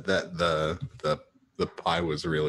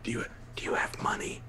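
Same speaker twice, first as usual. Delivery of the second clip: hushed, discreet question; whisper; 8 dB quieter, faster than usual. Real, not acted. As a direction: whisper it so the waitress can't hear. Asking companion discreetly at table.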